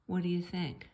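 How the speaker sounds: ordinary delivery, nothing marked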